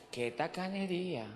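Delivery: low, soft aside; quiet, deflated rebuke; hushed reproach. Real, not acted. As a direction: drop right down in dismay. Contrasts stinginess with loud generosity.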